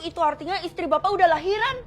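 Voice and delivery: usual speaking voice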